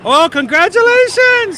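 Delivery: loud, high, drawn-out congratulations; excited. Real, not acted. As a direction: burst out with delight. Hearing couple just got engaged.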